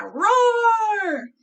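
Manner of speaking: big, high, loud roar; long drawn-out mock roar; playful dinosaur roar. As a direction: roar like a pretend T-Rex for kids. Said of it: "making fun animal noises".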